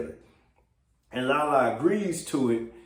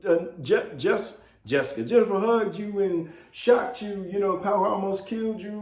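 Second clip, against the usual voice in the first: much higher, worried wife pleading; Lynn, upset; 7 semitones higher than usual. Retold or imitated. Wife begging Jefferson not to fly up, high and anxious. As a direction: beg him not to go. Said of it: "Lynn's all upset".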